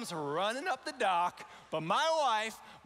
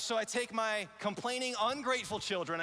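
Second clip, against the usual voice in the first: usual speaking voice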